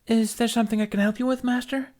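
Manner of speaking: put-on high, fast little girl voice; young girl's voice. A narrator's reading. Youngling asks the visiting master politely, high childlike voice. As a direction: voice her as a small eager child. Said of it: "asked the youngling".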